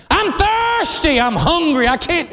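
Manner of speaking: very high, plaintive mock complaint; childish whine. Believer complaining to the Lord like a child, whining. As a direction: whine high like a small child, first words stretched.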